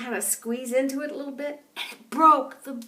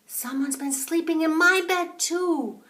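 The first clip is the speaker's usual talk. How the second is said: mama bear; 6 semitones higher, 5 dB louder than usual. A narrator's reading. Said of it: "the mama bear said".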